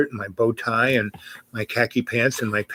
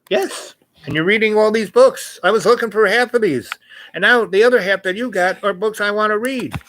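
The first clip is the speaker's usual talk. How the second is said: put-on eager young woman; 9 semitones higher, 3 dB louder than usual. Voicing Leslie eagerly.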